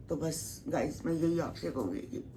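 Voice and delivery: ordinary talk, nothing marked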